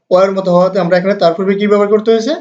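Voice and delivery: ordinary delivery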